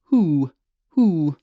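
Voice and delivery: who, who, airy H sliding into oo; aspirate who. Shown as a demonstration. Coach showing the aspirate onset: who, who breathed in. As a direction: breathe the H, then the oo. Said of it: "that H is our aspirate".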